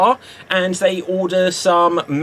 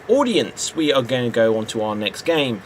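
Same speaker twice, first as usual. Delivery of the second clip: usual speaking voice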